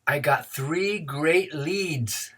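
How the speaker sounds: put-on very slow, drawn-out boyish boast; boy's punchline. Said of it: "And the boy said".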